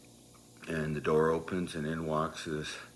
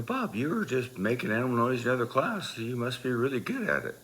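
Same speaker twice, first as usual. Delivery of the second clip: higher-pitched goading; mock coaxing; 7 semitones higher than usual. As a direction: egg him on in a raised, teasing pitch. Goading Bob to perform.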